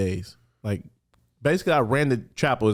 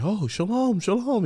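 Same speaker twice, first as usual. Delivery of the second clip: surprised rabbi; 10 semitones higher than usual. Retold or imitated. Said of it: "he was just like".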